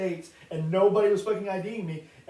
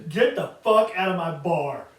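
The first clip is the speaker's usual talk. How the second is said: slow, loud angry order; angry bartender; 6 dB louder, slower than usual. Retold or imitated. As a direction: throw him out of the bar. Bartender throws him out.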